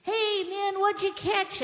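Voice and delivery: high-pitched hail across the water; acted yell. Man on the beach calls to the fishermen, yelling. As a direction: call out like someone hailing a boat from shore. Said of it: "the guy from the beach yells".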